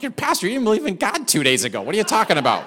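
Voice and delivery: mock disbelief at the claim; astonished. Pastor retells his shocked reaction to the congregation, incredulous.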